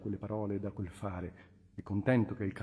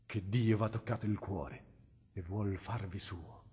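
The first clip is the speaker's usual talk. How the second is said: calm reply; 3 semitones lower, 5 dB quieter than usual. A narrator's reading. The cardinal answers the tormented man calmly and softly. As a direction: settle him with quiet certainty. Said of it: "rispose pacatamente il cardinale".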